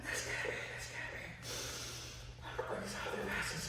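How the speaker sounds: acted worn-out, voiceless panting; spent, barely voiced muttering of disbelief; tired rabbit. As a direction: gasp it out as an exhausted rabbit. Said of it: "tired. He's all like that".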